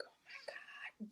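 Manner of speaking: breathy whispered plea for patience; whispered exasperation. Retold or imitated. Preacher's daughter whispering at a rude churchgoer, exasperated. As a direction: whisper a prayer to keep your temper. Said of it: "And I'm like".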